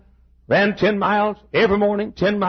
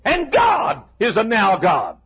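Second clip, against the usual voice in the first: preaching at full force; 11 semitones higher, slower than usual. Real, not acted. Preacher to congregation, shouting preaching. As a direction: lift the church with it.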